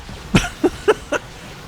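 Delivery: squeaky laughing fit; high breathy laugh. Real, not acted. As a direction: giggle helplessly. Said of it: "I was laughing too hard".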